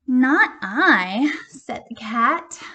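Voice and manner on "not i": cat's loud refusal. Acted. Cat to hen, loud refusal. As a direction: brush her off as the cat.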